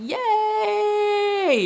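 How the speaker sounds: long, high reenacted cheer; high squealed cheer, held long; gleeful yelling. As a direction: imitate a drawn-out excited yell. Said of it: "I started yelling".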